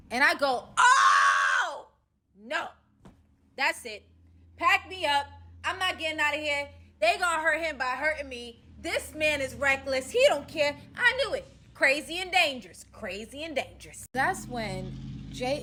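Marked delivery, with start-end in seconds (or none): 0.8-11.5 high, loud frantic rant; retelling her alarm, high and loud; panicked shouting. Retold or imitated. She panics as threats escalate. 11.7-12.8 high, loud "Crazy and dangerous"; loud first take of "Crazy and dangerous"; loud panic. Retold or imitated. Panicked repeat.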